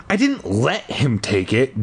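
ordinary delivery, nothing marked

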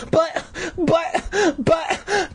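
very high, squeaky stammered protest; sputtering. A narrator's reading. Boy protesting to his mother, sputtering. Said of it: "Todd sputtered".

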